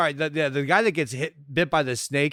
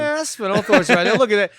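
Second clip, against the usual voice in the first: excited; 5 semitones higher, 6 dB louder, faster than usual. Real, not acted. Excited spotting of actor.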